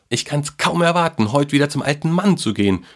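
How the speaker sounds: high, fast, bursting with eagerness; a boy who can't wait, rushing the words out; excited boy. A narrator's reading. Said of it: "rief der Junge begeistert".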